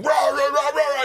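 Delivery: high-pitched wordless mock yelling; imitated yelling. Friend retelling a street commotion in Chicago, imitated yelling. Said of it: "All you hear is yelling".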